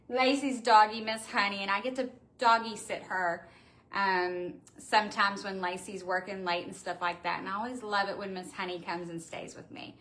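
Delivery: ordinary talk, nothing marked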